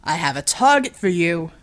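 loud call over the fight; shouted call. Acted. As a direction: shout the order to him. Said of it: "shouted Matai".

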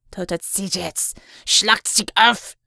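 slow, rising to a loud German command; barked German order. A narrator's reading. Villain commanding his soldiers, loud German. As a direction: order the troops to fire.

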